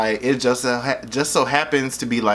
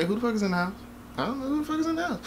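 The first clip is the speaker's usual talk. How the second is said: high, hushed, quick asking; soft, high-pitched puzzled aside; hushed mock alarm; 10 semitones higher, 8 dB quieter, much faster than usual. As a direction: imitate whispering about an intruder downstairs. Mom and sister overhear strangers downstairs.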